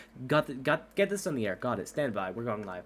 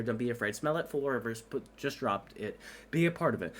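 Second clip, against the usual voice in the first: usual speaking voice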